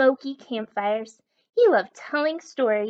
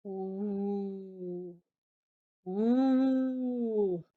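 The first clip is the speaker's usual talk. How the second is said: monster moan; 4 dB quieter than usual. A narrator's reading. Maxwell moans like the mountain monster to scare his friends. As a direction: moan long and eerie like a monster. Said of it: "Maxwell made a terrible monster moan".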